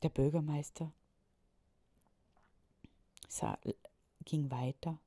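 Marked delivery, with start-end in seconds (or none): none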